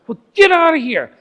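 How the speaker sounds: high, loud order to leave; urgent high command. Retold or imitated. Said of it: "He said".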